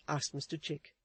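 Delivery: plain reading voice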